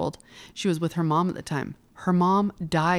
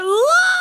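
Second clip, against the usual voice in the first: retold emotional cry; 8 dB louder than usual. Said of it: "I'm like".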